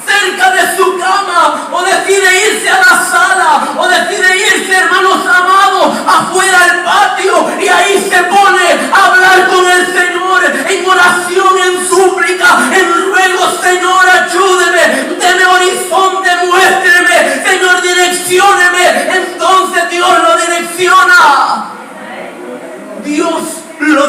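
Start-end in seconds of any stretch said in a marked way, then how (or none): 0.0-13.5 fervent pulpit preaching, every phrase pushed out; preaching at full force. Acted. Preacher rousing his congregation, preaching at full force. 13.6-19.2 pleading prayer. Retold or imitated. Believer's prayer voiced in sermon. 19.2-21.7 fervent pulpit preaching, every phrase pushed out; preaching at full force. Acted. Preacher rousing his congregation, preaching at full force.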